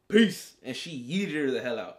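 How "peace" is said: loud, high-pitched mock farewell; mock sendoff. Wanda throwing her neighbor out, loud mock farewell. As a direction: fling the word out loud like a dismissal. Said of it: "she said".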